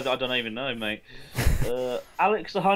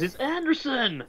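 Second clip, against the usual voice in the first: high, drawn-out bad American accent; hammed-up American twang, high and slow; terrible American accent; 10 semitones higher, slower than usual. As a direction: put on a bad American accent, stretched out and pitched up. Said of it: "that was a terrible American accent".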